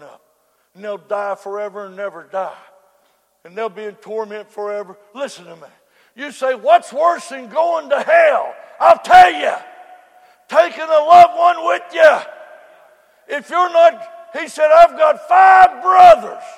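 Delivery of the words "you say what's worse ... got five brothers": preaching shout. Acted. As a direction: drive it home at full voice. Preacher at full force.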